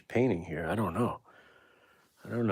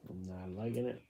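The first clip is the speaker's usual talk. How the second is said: much softer, under the breath; muttering to self; 12 dB quieter than usual. Real, not acted. Painter unhappy with the painting, muttering to self. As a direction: repeat it quietly to yourself.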